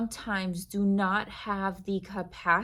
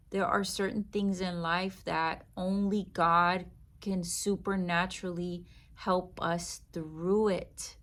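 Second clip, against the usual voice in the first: ordinary talk, nothing marked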